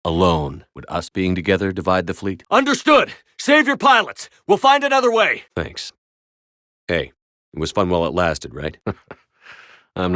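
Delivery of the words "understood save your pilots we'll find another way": high, loud shouted orders; battle shout. Acted. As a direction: yell it across the battlefield. Battlefield orders over combat noise.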